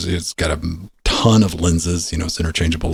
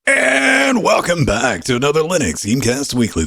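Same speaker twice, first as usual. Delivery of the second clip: announcer shout; 8 semitones higher than usual. Real, not acted. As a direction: belt out the welcome like a host. Show intro shouted opener.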